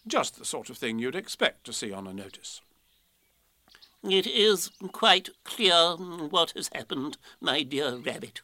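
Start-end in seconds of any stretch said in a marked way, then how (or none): 4.0-8.4 slow, pompous owl explanation; learned Owl, condescending. Acted. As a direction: explain grandly as a self-important owl.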